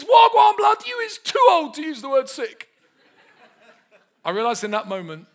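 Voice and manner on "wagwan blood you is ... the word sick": high, loud London youth voice with a laugh; cheeky teenager. Retold or imitated. Fourteen-year-old teasing the preacher, high and loud. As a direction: shout it out like a mocking teen. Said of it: "this fourteen-year-old comes up to me and goes".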